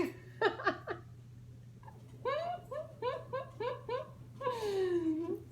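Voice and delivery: long giggling fit; can't stop laughing at the frog; real uncontrolled giggles; helpless laughter. Real, not acted. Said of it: "I laugh every time I see that one".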